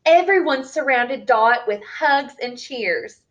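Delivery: plain reading voice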